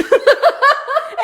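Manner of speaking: loud, high-pitched laughing fit. Real, not acted. Laughing at the NPC story.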